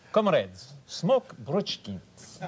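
slow, mock Russian cigarette slogan; rally the comrades in a put-on Russian accent; drawn-out Russian announcer voice; Russian advert accent. Shown as a demonstration. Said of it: "Even in Russia, I did one once".

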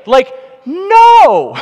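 loud, high mock outrage at the idea; emphatic shout. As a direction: shout the no like it's absurd. Preacher mocks crowd's reaction.

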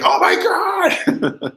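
very high, drawn-out squeal; squealed fan freak-out. Retold or imitated. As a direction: re-enact losing it with a long high-pitched cry. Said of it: "freaking out".

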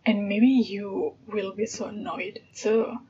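usual speaking voice

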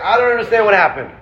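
playful exasperated rabbi baffled by his people; mock exasperation. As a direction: rattle it off in hammed-up disbelief. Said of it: "He's like".

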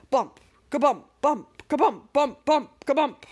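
loud rhythmic bump chant; bouncing bumps. Acted. The toboggan racing downhill, a loud bouncy bump chant. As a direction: pound out each bump.

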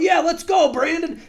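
very high, loud rallying shout; imitated cheering yell. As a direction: yell it like a hyped-up passenger. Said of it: "yelling this on the plane".

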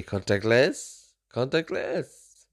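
slow, drawn-out mock French accent; imitating someone's bad French accent. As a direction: stretch the English word out in a poor French accent. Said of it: "in a bad French accent".

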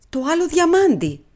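ordinary delivery, nothing marked

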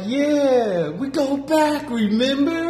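very high, drawn-out fake-friendly voice; imitating the line cutter. Mocking the woman who claims to know Reggie, very high and drawn out. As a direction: play the stranger pretending to be an old friend, high and slow. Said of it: "She's like".

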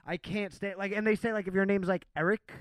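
ordinary talk, nothing marked

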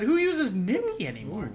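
can't believe the word, laugh through it; laughing disbelief. Retold or imitated. Telling Jordan about the golfer, laughing disbelief. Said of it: "I said".

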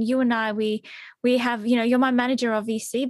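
usual speaking voice

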